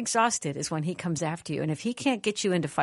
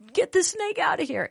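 much higher, urgent mom voice; retold alarmed order; 14 semitones higher, 3 dB louder than usual. Scared mother tells her arguing sons off, high urgent order. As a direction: act out a panicked command, pitched way up.

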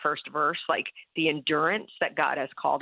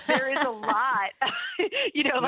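laughing; 8 semitones higher than usual. Real, not acted. Laughing at the quote.

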